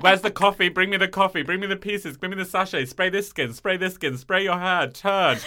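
diva demands. Retold or imitated. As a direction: play the demanding diva at the counter. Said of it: "It's a full performance".

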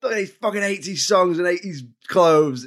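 hammed-up, pointing at everything eighties; mock excited film voice. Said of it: "where they've gone".

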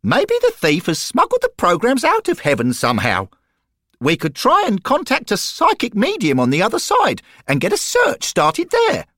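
put-on high, bright young boy voice; eager schoolboy piping up; high treble of a keen boy; boy's voice. Derek's eager suggestion.

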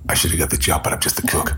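low, hushed Seagal impression; Steven Seagal. Co-host quoting a film cook, low hushed Seagal impression. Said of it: "That's Steven Seagal".

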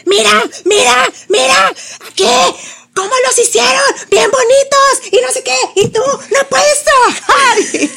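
high, loud furious yelling with a laugh; exploding rant. Retold or imitated. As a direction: lose it completely at the kid. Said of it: "ahí fue cuando exploté".

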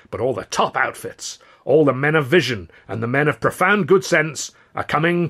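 high, loud Southern politician; showman's oratory; booming mayor. Acted. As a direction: play to the crowd grandly. Mayor speech voice held.

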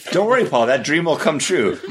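playing the all-knowing seer, mock solemn; mock prophecy. Teasing Paul about a Star Wars park, mock prophetic. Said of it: "I'm speaking to you like you're from the past".

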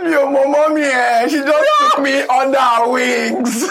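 high, slow wail through laughter; cracking up. Real, not acted. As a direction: drag it out while falling about laughing. Helpless laughter at own joke.

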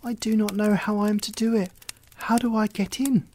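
put-on high quick little girl faking puzzlement; girl feigning confusion. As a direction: play innocent to trick her. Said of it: "Gretel saw what she had in mind and said".

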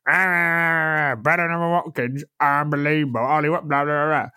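drawn-out mock gushing, garbled into blahs; exaggerated babbling impression of a friend; mocking imitation. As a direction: parody the co-host raving on. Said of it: "And you were going".

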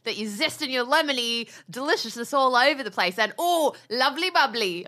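enthusiastic put-on British chef accent; Jamie Oliver. Host as TV chef zesting a lemon, mock British accent. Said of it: "back to my Jamie Oliver minute".

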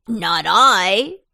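loud, punchy pumpkin exclamation; defiant cry. A narrator's reading. Pumpkin refusing to go back to the patch, crying out. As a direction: burst out loudly with a firm refusal. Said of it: "cried Pumpkin".

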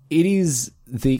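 usual speaking voice

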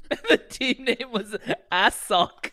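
high, slow, laughing out the words; laughing while speaking. Real, not acted. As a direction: giggle through the punchline. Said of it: "laughing through it".

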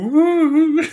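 high, loud tipsy whoop; drunk [name] whoop. Retold or imitated. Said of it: "He's just going".

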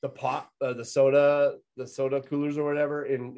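ordinary talk

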